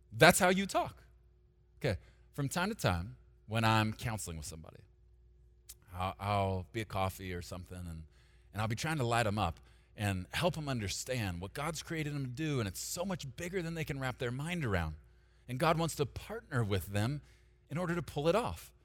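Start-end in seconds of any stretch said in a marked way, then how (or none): none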